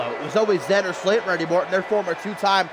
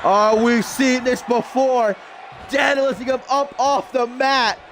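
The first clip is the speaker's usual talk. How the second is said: excited play-by-play; 7 semitones higher, slower than usual. Acted. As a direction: call the action like a ringside announcer.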